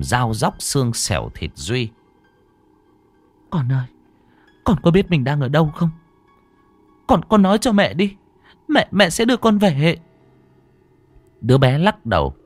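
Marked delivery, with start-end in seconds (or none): 3.5-10.0 urgent plea. Acted. Mother pleads with child.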